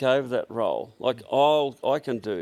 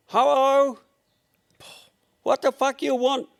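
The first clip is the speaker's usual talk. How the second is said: hammed-up mock Chinese accent, much higher and louder; 13 semitones higher, 5 dB louder than usual. Staffer fooling a pushy caller on the phone, mock Chinese accent, high and loud. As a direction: squeal it in a put-on Chinese accent like a rude phone clerk. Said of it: "pretends he's a Chinaman".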